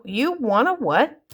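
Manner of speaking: incredulous question. Retold or imitated. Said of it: "I look at him and I go".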